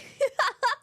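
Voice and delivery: real high-pitched laughter; high laughing squeal. Real, not acted. Laughing after horse line.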